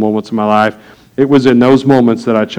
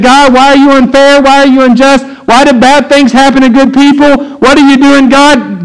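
much higher and louder, crying out at heaven; anguished outcry; 12 semitones higher, 5 dB louder than usual. Retold or imitated. Habakkuk protesting to God, loud anguished outcry. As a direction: shout the complaints up at God. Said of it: "Habakkuk said".

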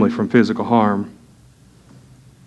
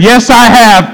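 put-on much higher, louder child's reply; little boy answering; 9 semitones higher, 6 dB louder, much slower than usual. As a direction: answer bright and high like a small kid. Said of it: "Isaac said".

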